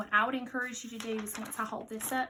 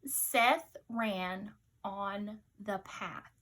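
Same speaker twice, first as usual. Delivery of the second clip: slow, evenly spaced words; slow dictation pace; much slower than usual. Shown as a demonstration. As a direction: dictate each word slowly. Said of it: "Let's listen one more time".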